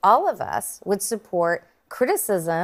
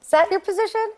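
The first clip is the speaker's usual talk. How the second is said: incredulous challenge; 10 semitones higher than usual. Real, not acted. Incredulous jab at opponent in debate.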